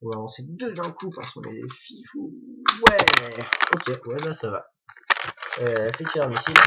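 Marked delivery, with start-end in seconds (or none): 2.9-3.4 loud triumphant whoop; one big, much louder "yeah"; excited cheer. Real, not acted. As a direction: cheer loudly as it fires. Missiles fired, mock-daredevil cheer.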